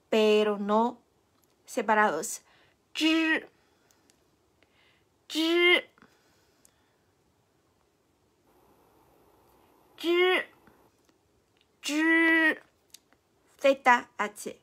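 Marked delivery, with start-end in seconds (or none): 3.0-12.6 Zhi high, retroflex, unaspirated; Chinese zhi. Shown as a demonstration. Teacher practising zhi with viewers. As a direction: watch my mouth: zhi.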